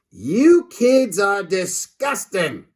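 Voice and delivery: high, slow, disgusted grown-up voice; a cranky principal recoiling at lunch. Acted. Principal grimacing at the boys' sandwiches, slow and high.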